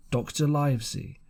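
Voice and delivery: plain reading voice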